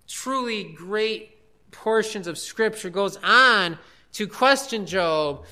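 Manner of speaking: ordinary talk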